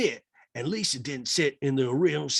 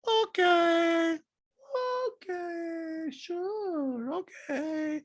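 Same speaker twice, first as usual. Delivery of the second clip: high, drawn-out falsetto; mock dismissive singing-out; Diana impression voice; much slower than usual. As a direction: float each word up high, apart.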